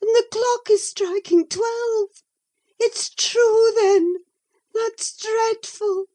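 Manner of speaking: high, loud, breaking into a cry; terrified. A narrator's reading. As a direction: horror at the striking clock. Catherine terrified at midnight.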